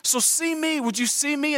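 small, high pleading voice; childlike plea. Retold or imitated. Adult asking the world for notice, in a childlike high voice. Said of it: "trying to say to the world".

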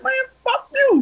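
very high, indignant retold comeback; heated curse back. Him cursing back at the driver, high and heated.

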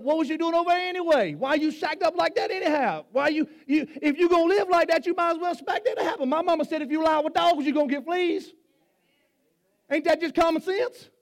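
high, loud scolding preacher; mock scolding voice. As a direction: scold them like a nosy neighbor.

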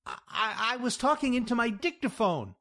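high, fast, stammering excuse; stammer, then rush the excuse in a high voice; desperate babble. A narrator's reading. Jack covering up to Louise, running on desperately. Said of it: "I ran on desperately".

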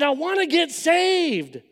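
earnest plea. Retold or imitated. Young man pleading to friends to be saved, strained and slow. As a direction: make them hear you mean it. Blurting out wish for salvation.

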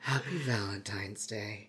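slow spooky whisper; creepy whisper. Acted. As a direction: whisper it like a killer.

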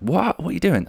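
lightly voiced high bafflement; mock bafflement. Classmates react in a light mock baffled voice. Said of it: "everyone just kind of went".

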